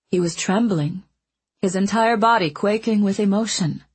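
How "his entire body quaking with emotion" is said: usual speaking voice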